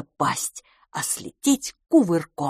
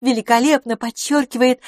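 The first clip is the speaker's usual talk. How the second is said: higher, louder, emphatic praise; admiring lady friend; 7 semitones higher, 5 dB louder than usual. Acted. Lady admiring her guest's outfit, admiring. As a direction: voice the lady, lifting "Великолепно подчёркивает". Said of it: "повторила она".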